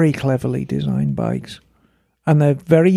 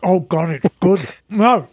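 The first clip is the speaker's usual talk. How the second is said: talking through a cookie; words round a mouthful of biscuit; mouth full. Real, not acted. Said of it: "I've got a Scottish cookie in my mouth".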